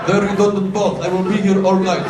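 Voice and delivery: loud, hammed-up Russian accent; booming mock-Russian stage sign-off. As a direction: play a Russian comic in a thick put-on accent. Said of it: "your heavily Russian accent".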